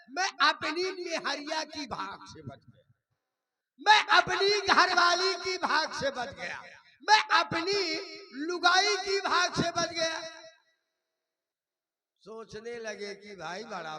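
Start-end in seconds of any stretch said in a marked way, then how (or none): 3.8-10.2 high, loud stage declaration; belting out credit to the wife; loud proclamation. Acted. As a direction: announce it proudly to the whole crowd. Proclaims wife's luck saved him.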